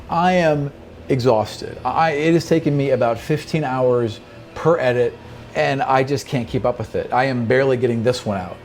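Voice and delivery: ordinary talk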